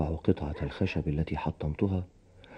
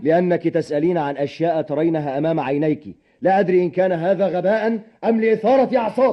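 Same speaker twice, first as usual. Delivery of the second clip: loud, high-pitched exasperation building to a shout; irritated outburst; 11 semitones higher, 5 dB louder than usual. Acted. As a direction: lose patience and raise your voice sharply. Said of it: "ولا أقصد أبدًا أن أثير أعصابك". (بانفعال وصوت عالٍ غاضب)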